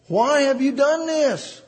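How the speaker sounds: high, slow, anguished divine question; voice of God. Shown as a demonstration. Preacher voicing God with emphasis. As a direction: give God's question full emphasis. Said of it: "If you want to put some emphasis, this is God talking".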